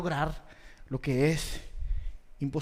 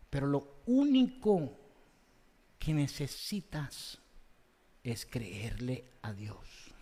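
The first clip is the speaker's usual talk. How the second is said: soft altar-call appeal; 5 semitones lower, 4 dB quieter than usual. Real, not acted. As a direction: draw them in gently. Soft closing appeal to listeners.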